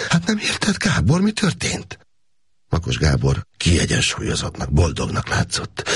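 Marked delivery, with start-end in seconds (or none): 0.1-2.0 high, alarmed cry of disbelief; a scared girl pleading, voice shooting up; frightened plea. A narrator's reading. Frightened Erzsébet pleads with Gábor, pitched high and alarmed. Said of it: "Szolga Erzsébet megrémült".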